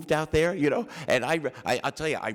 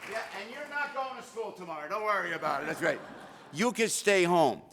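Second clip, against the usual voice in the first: talking to his daughter; 9 semitones higher, 4 dB quieter than usual. Real, not acted. Stepping down to address his daughter.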